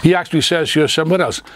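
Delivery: normal talking voice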